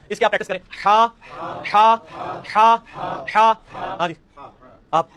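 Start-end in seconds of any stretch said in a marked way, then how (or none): 0.7-3.5 हा breathed from the middle of the throat; हा, strong breathy throat h; throat h drill. Shown as a demonstration. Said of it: "हा के ऊपर वस्ते हलक से".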